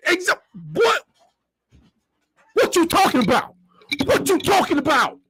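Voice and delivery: high, squeaky disbelief at the idea; can't believe what he's hearing. Real, not acted. Stunned by yard man idea.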